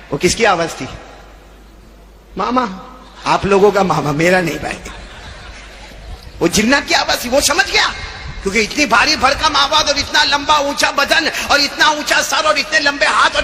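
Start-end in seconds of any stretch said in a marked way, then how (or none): none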